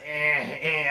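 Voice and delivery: half-hearted stab at a cartoon character's noise; Popeye. Showing the voice the film actor lacks, badly faked imitation. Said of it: "I can't really get the voice".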